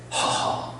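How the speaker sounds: soft, breath-only sigh; exasperated sigh. Acted. Jonah fed up waiting for judgment, exasperated sigh. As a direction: let out a voiceless huff.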